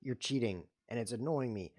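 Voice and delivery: normal talking voice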